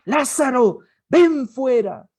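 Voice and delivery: loud, slow, high command to come out; booming slow summons; commanding call. Retold or imitated. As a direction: call the dead man out with full authority. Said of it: "Jesús dijo".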